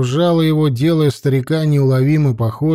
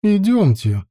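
put-on higher, commanding old woman; lightly voiced stern priestess; 6 semitones higher than usual. A narrator's reading. Lokša voiced, calling girls.

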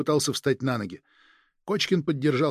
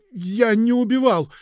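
terrified denial; 8 semitones higher, 5 dB louder, slower than usual. Acted. Frightened suspect denies.

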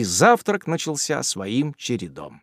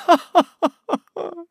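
shrill hysterical cackle, much higher; hysterical laughter. A narrator's reading. Aunt breaks into hysterical laughter over Tupman. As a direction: burst into wild hysterical laughing. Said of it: "второй номер истерического хохота".

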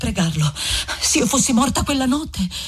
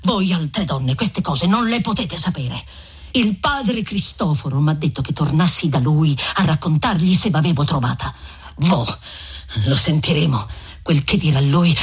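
put-on a lowered, grumbling young man pushing back; Renzo's voice; 7 semitones lower than usual. Renzo arguing with Lucia, in a lowered grumbling voice.

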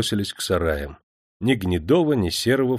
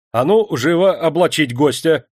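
loud, raised command; commanding shout, higher; brisk order; 6 semitones higher, 6 dB louder than usual. A narrator's reading. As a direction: order the men into action. Manager orders his men about.

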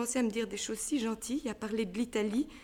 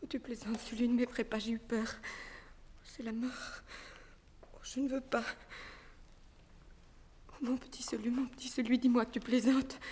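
faint fear; 3 dB quieter than usual. Acted. Mourante, effrayée.

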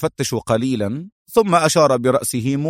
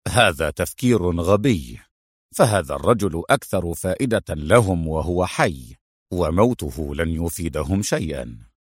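low-pitched rebuke, not raised in volume; dismissive exclamation; 6 semitones lower than usual. A narrator's reading. Adham rebuking Mona's theory, low and dismissive. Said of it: "صاح أدهم".

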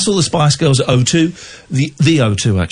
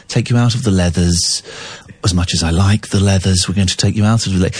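posh Epstein impression; 7 semitones lower than usual. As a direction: play Brian Epstein, posh and patronising. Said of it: "he was very posh".

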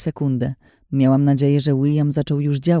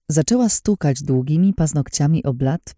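ordinary delivery, nothing marked

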